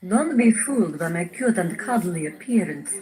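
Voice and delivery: ordinary delivery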